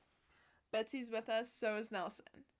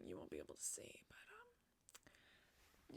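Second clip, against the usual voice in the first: unvoiced whispered aside; 10 semitones higher, 19 dB quieter, slower than usual. Real, not acted. Vlogger whispering an aside to audio listeners.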